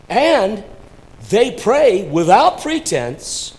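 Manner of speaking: high, loud pulpit delivery; emphatic preaching. Real, not acted. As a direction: drive each phrase home to the congregation. Preacher drives the lesson home.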